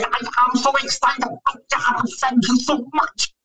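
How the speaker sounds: exaggerated Spanish accent, thrilled and thankful; mock Antonio Banderas. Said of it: "and he can't say".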